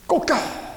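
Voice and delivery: long, high, stretched crow; rooster crow. Acted. Preacher imitating the cock crowing at Peter's denial, a high stretched crow. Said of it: "통곡하고 우는데".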